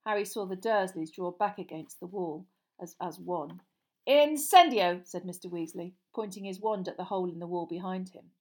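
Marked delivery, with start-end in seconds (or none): 4.1-5.0 loud, raised incantation; spell incantation. A narrator's reading. Mister Weasley lighting the fire by magic, loudly incanting. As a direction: call the spell out with force. Wizard casts a spell aloud.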